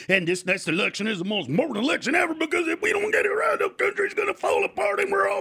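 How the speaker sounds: escalating hysterical wail, climbing high; hammed-up doomsday hysteria; mock panic. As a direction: build from fast ranting to a high, whiny shout. Mimics panicky election worriers.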